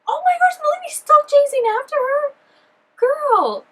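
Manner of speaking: very high, loud disbelieving squeal; excited shriek. Real, not acted. Lady still chasing, shrieking reaction.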